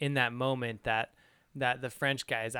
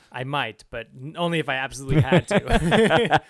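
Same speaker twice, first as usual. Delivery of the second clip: lightly voiced tiger, louder and laughing; tiger's reluctant answer; 2 semitones higher, 8 dB louder than usual. Co-host voicing the tiger's answer about the carrot, louder through laughter. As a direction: play the tiger admitting it grudgingly, with a laugh. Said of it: "and he goes".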